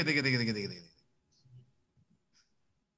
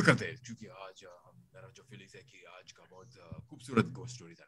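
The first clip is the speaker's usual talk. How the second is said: trailing off; 9 semitones lower, 16 dB quieter, faster than usual. Real, not acted. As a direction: voice fading away to nothing. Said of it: "Whenever your voice fades out".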